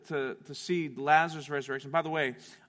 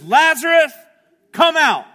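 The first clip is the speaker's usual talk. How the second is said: high, booming shouted command; calling out loudly; 13 semitones higher, 17 dB louder than usual. Retold or imitated. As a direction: shout it out as if to wake the dead. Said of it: "he calls out in a loud voice".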